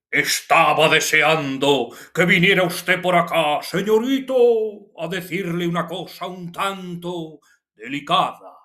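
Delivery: hammed-up old-time travelling player, projecting high; Fernando Fernán Gómez, hyperbolic. Teacher imitates a touring village comedian's film speech, hammed-up. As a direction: open loud and high, then ease into grandiose declamation. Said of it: "de una manera un tanto hiperbólica".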